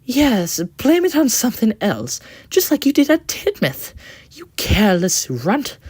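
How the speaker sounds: high, loud scornful scolding; angry coach. Acted. The coach angrily scolds Thomas, high and loud. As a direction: lash out at him in a high sneer. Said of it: "the coach replied angrily".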